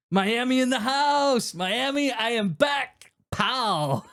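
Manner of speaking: high, drawn-out crowing; big showy comeback call; triumphant announcement. Acted. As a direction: stretch the words out high and proud. Acts out triumphant film line.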